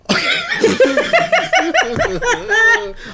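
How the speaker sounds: high-pitched, drawn-out laughing fit; long burst of very high laughter; laughing hard. Real, not acted. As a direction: crack up for real. Cracks up at own line.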